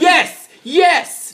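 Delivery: loud, high-pitched exasperated yes; emphatic insistence. Real, not acted. Insisting he already answered.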